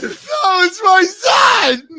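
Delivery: high, loud, very slow wailing sob; mock crying kid. Friend joining in as a crying child, mock wail. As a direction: drag it out, crying like a small child.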